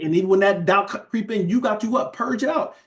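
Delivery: high, rising preacher's exhortation; preaching at full force. Acted. Preacher urging the congregation to resist doubt, fired-up preaching. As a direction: drive it home to the church, pitch climbing.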